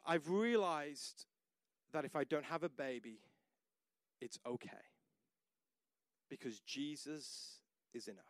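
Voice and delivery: a hushed, tender hint of tears; tearful wife. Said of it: "with tears in her eyes".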